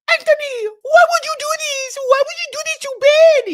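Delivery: put-on very high, loud accented wailing; mock-distraught accented wife. Wife reproaching Anthony about Ben, mock-distraught accented wail. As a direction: imitate her accent in a shrieky, overdramatic plea. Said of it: "every time I do this accent".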